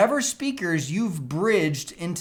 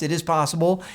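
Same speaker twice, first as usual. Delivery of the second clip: ordinary delivery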